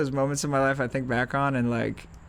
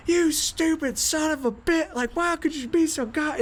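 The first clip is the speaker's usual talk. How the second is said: angry coach yell; 13 semitones higher than usual. Retold or imitated. Coach tearing into his athlete by the fence. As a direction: scream it down at the kid, much higher.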